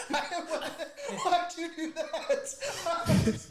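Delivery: high, loud laughing protest. Real, not acted. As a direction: laugh through the question. Friend laughs at the joke.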